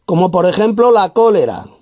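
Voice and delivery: high, proclaimed verse reading; emphatic scripture reading. Real, not acted. Preacher reads out the listed sins, high and emphatic.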